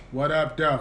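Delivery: playful Detroit greeting, drawn from home. Shown as a demonstration. Guest greets podcast host in his Detroit voice. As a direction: greet him in a Detroit accent. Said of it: "In my Detroit voice".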